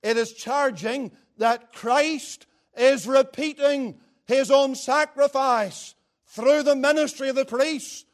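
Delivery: high, loud pulpit delivery; full-force preaching. Real, not acted. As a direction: drive each phrase home, building to a loud finish.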